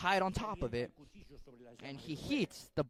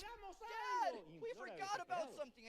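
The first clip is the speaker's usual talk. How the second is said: put-on much higher and softer, a child's desperate plea; boy imploring; 14 semitones higher, 13 dB quieter than usual. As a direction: beg your father as a small boy. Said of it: "he implores desperately".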